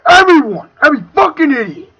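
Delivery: furious rant; angry shout. Real, not acted. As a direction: blast the word out in anger.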